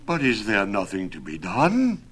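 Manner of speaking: dismayed, pleading question; grief-stricken plea. A narrator's reading. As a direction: plead desperately for some remedy. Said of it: "For grief at this".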